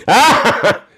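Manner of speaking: loud high burst of laughter; laughing. Real, not acted. Host cracking up at the chat, laughing. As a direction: let out a sharp "ah" of laughter.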